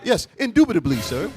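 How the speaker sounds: high, loud prissy "Indubitably"; mock proper employee. Mocking the polite work voice, high and loud. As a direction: pose as an overly polite worker. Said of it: "You gotta be like".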